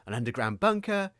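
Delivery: ordinary delivery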